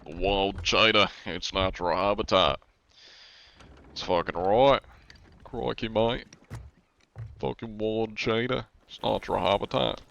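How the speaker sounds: put-on Aussie wildlife presenter; Australian accent. Shown as a demonstration. Streamer presenting a wild cheetah, Australian accent. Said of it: "There's different kinds of Australians".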